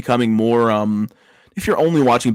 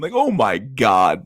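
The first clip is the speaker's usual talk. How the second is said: ordinary delivery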